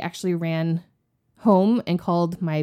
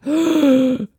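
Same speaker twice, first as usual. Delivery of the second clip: team gasping; 7 semitones higher, 5 dB louder than usual. Retold or imitated. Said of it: "they all were like".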